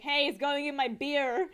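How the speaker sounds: loud, drawn-out mock complaint; half-joking shout. People shouting at a bubble waffle stand, half-joking complaint. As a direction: call out a protest, only half joking. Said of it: "kinda jokingly".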